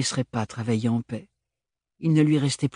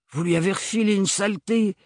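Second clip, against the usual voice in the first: higher, urgent alarm; panicked; 8 semitones higher than usual. Acted. Comrade accusing her, mildly panicked. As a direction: blurt it out in a panic. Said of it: "paniqua l'un d'entre eux".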